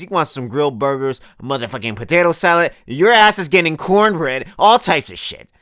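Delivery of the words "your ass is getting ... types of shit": ordinary delivery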